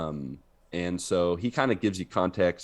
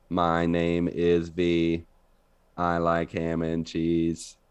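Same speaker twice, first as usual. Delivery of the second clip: slow, even monotone robot voice; slower than usual. Retold or imitated. Retelling his first voiceover gig, monotone robot. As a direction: recite it slowly like a robot. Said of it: "speak in a monotone voice".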